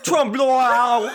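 Trump loyal high and hammed-up, vowels smeared; botched pronunciation. Shown as a demonstration. Hammed-up botched pronunciation everyone mocks.

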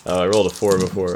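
ordinary talk, nothing marked

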